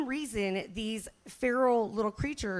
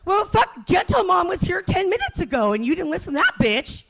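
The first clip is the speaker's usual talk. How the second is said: angry mom; 8 semitones higher, 8 dB louder than usual. Retold or imitated. Mom snapping back at kids.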